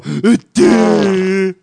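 dopey caricature grunt; mocking doofus impression; Ryan. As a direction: put on a dim-witted co-host voice. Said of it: "I'll do my best Ryan impression".

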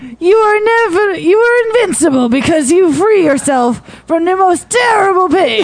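high, dramatic mock Russian accent; Borat-style Russian accent. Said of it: "What happened to your Russian accent?".

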